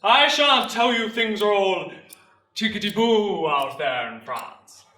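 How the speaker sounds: slow, booming public address; parade-ground orator opening his speech; recruiting sergeant major. Acted. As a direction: address the whole square, drawing the words out.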